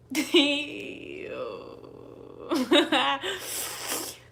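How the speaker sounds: long high laugh with loud bursts. Real, not acted. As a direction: let out a drawn-out, high laugh. Laughs off own anger.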